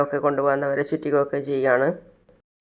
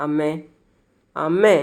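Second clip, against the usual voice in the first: a retold call for a mother's attention; slower than usual. Son sitting beside his mother, calling to her. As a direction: call out to mother, second call lifting at the end. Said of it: "വിളിക്കുകയാണ്".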